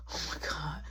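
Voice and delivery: soft, low, voiceless murmur; breathless whisper. Retold or imitated. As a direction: act out being overwhelmed, under the breath. Retold overwhelmed reaction during intimate moment.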